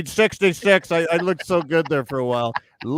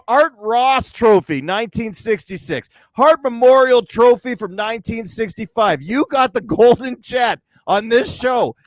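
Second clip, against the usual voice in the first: ordinary talk, nothing marked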